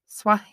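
Swathi said with a TH sound. Shown as a demonstration. Co-host repeats the name, Swathi with TH. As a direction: put a TH in the middle of Swathi. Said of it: "like, T H sound in her name".

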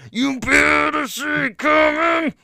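high, slow, loud shout; hyped mock wrestling shout; mock entrance line. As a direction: call it out like an arena entrance. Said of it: "came out to that".

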